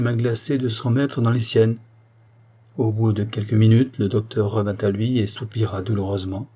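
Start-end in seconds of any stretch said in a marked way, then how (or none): none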